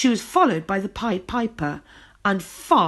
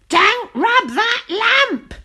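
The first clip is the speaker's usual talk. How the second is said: high, loud, slow witch shout; slow shrill-free warning shout much higher; 8 dB louder, much slower than usual. A narrator's reading. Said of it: "shouted the friendly witch".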